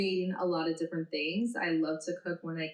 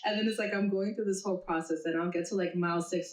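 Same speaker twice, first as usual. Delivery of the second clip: normal talking voice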